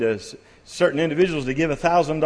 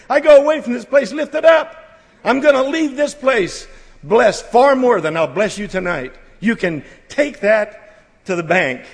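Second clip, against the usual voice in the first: higher and louder, rising fervour; preaching at full force; 6 semitones higher, 3 dB louder than usual. Real, not acted. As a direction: lift the congregation up with rising passion.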